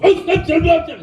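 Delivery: high imitated stammer; senpai's high voice. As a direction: sputter it in a high put-on voice. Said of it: "he goes".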